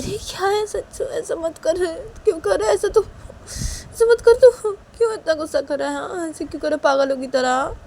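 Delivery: very high, pleading reproach; alarmed scolding mother. Acted. मां गुस्सैल बेटे को रोकती है. (घबराई माँ की ऊँची डाँट)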